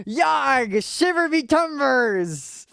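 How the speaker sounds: high, slow hammed-up pirate intro; mock pirate host voice; pirate accent. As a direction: open the episode like a cartoon pirate captain. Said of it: "I'll do a pirate accent".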